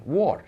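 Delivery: "ward" with the O said as written; foreign mispronunciation. Shown as a demonstration. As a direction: say "ward" to rhyme with lord, as Europeans misread word. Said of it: "O is O".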